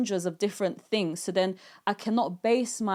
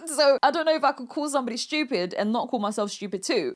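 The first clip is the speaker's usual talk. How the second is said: usual speaking voice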